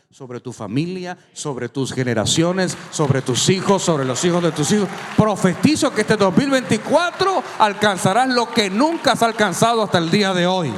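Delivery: preacher prophesying at full force over the crowd; full-force preaching. Acted. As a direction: build up to a roaring proclamation.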